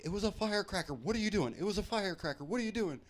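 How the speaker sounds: high, insistent retelling of a protest; adamant protest. Retold or imitated. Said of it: "I kept being adamant".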